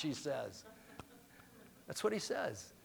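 usual speaking voice

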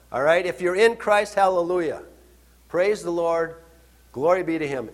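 ordinary talk

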